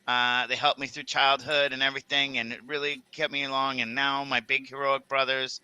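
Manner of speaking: ordinary delivery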